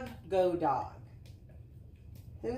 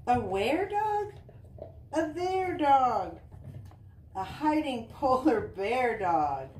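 higher, louder playful hide-and-seek reading; reading to children; 6 semitones higher, 7 dB louder, slower than usual. Acted. Mom reading to Easton, playful peekaboo read-aloud. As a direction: tease the kids with where and there.

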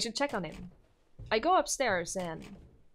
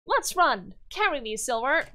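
put-on higher, haughty child voice, smug; a spoiled little king showing off; bratty boy king; 4 semitones higher, 4 dB louder than usual. Yuri boasting to Silver, higher smug child voice.

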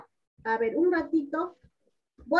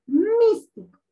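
"Místico" with a high, loud first syllable; "Místico" showing the strongest syllable at the start; stress on MÍS; 6 semitones higher, 5 dB louder, slower than usual. Shown as a demonstration. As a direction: hit "Mís" hard, let "tico" drop away. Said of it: "la sílaba que tiene la mayor fuerza de voz".

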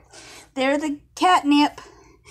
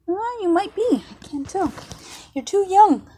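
ordinary delivery

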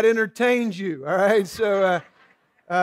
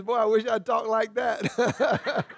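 laughing; 6 dB quieter, slower than usual. Real, not acted. Laughs at his own accent joke.

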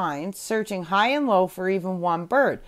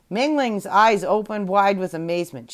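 ordinary delivery

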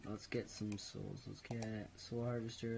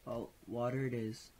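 normal talking voice

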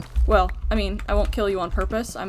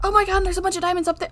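higher, louder and fast with delight; excited; 9 semitones higher, 5 dB louder, faster than usual. Real, not acted. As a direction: blurt it out thrilled at the loot. Finds a bunch of diamonds.

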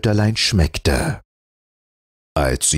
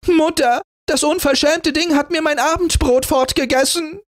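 much higher, tearful indignant tattling; furious complaint. A narrator's reading. Sister accusing Rotnas before mother, furious. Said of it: "rief sie weinend vor Wut".

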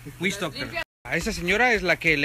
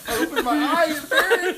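laughing in pain; 10 semitones higher, slower than usual. Real, not acted. Eyes burning from spicy noodles.

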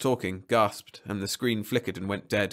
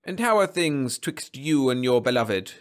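slow, louder, higher genial greeting; smiling; 4 semitones higher, 5 dB louder than usual. A narrator's reading. Marriage counsellor checking in on a client, smiling. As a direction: ask it with a big smile.

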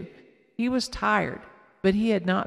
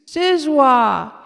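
put-on high, loud insistent child; insistent toddler; 6 semitones higher, 10 dB louder than usual. A narrator's reading. Casey insisting to Nella, high loud toddler voice. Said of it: "Casey insisted".

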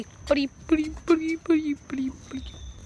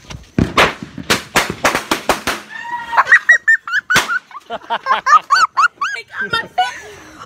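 high shrieking laugh. Real, not acted. Huge laugh after prank.

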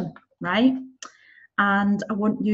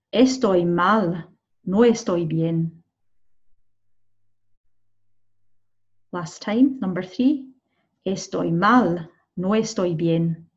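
very slow, deliberate read-out; slow dictation; 4 semitones lower, slower than usual. Shown as a demonstration. Teacher dictating answers to students, very slowly. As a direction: drag each word for the learners.

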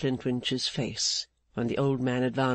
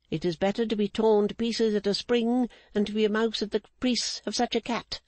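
Mrs Clennam; 6 semitones higher than usual. Acted. Mrs Clennam refusing Rigaud's demand, higher stern unbending woman. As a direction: hold the line like an unbending matriarch.

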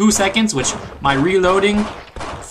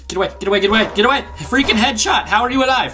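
high frantic yelling; scared and angry; 6 semitones higher, faster than usual. Real, not acted. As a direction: yell in panic, pitch climbing. Fighting off monsters in panic.

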